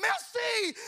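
shouted in a mock French accent; high, loud French-accented cry of surrender. Man in a chokehold trying French, shouted French accent. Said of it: "say it in a French accent".

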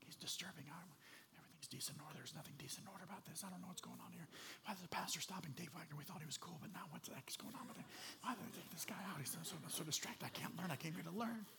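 scandalized churchgoers gossiping in a whisper; hushed, unsettled congregation whisper; whispering among themselves. Acted. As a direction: whisper a stream of hushed complaints. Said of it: "They're whispering amongst themselves".

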